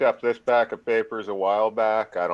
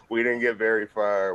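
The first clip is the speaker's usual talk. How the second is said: normal talking voice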